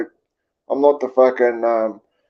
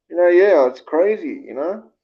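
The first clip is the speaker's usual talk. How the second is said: ordinary delivery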